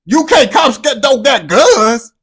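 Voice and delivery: amazed outburst. Real, not acted. Amazed that UK police are unarmed.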